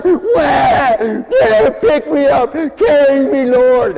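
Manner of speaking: high, loud wailing crybaby voice; bawling baby. Retold or imitated. Preacher mocking a lazy churchgoer as a wailing baby. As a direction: wail it like a spoiled toddler demanding to be held.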